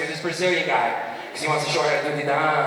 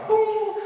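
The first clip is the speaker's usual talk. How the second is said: mock intercom boom. Intercom click effect.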